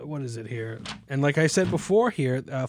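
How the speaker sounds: ordinary talk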